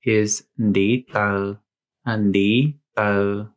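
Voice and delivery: slow careful demo; Hmong word. Shown as a demonstration. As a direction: say "ndi tau" slowly, in Hmong tones. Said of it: "the word for a toe".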